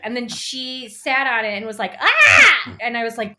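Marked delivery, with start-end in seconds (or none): 2.0-2.8 high, loud yelp of disgust; disgusted shriek. Retold or imitated. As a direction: shriek like you sat on something gross. Character sits on toilet rim.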